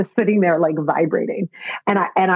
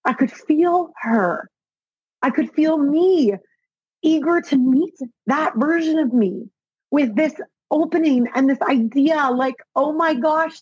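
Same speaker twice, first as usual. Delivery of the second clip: higher voice, slowing, moved close to tears; emotional; 7 semitones higher than usual. Real, not acted. Telling listeners about the Reiki session, choked up with real emotion. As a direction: let the feeling rise and catch in your voice. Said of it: "I'm getting emotional talking about it".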